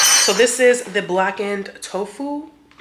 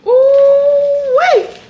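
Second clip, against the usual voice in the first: high, loud delighted squeal; dramatic squeal; 11 dB louder than usual. Real, not acted. Spotting something tasty in her meal, a dramatic squeal. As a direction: squeal it over the food, much higher and louder. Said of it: "I'm so dramatic".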